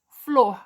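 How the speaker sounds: "Flor" in one emphatic beat; one single sound. Shown as a demonstration. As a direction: say "Flor" all at once, firmly. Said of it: "É um som, um único som".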